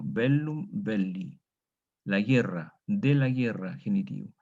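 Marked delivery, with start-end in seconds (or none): 0.0-1.4 bellum belli read slowly, each word drawn out; slow model reading. Shown as a demonstration. Teacher reading the vocabulary entry to students, slowly. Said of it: "como dice el vocabulario".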